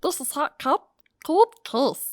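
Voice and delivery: high, sped-up cartoon squeak; chipmunk voice. Shown as a demonstration. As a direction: pitch it up like a chipmunk. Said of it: "your chipmunk voice".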